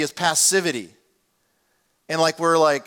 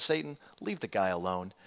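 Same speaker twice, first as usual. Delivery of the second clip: quiet command; 8 semitones lower, 9 dB quieter than usual. Acted. God orders Satan off Job.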